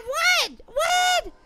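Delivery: high-pitched, startled questioning; shocked disbelief. Real, not acted. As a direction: cry out in baffled shock. Just killed unexpectedly in game.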